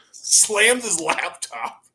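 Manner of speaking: usual speaking voice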